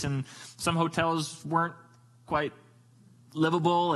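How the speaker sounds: normal talking voice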